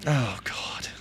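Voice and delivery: whispered, under his breath; whispered aside. Real, not acted. Host muttering about butchering names, whispered. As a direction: whisper the self-mockery to yourself.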